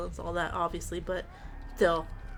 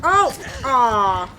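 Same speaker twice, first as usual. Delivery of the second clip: loud frustrated groan; disappointed groan; 9 dB louder than usual. Real, not acted. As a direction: groan at the blunder. Missed the pallet.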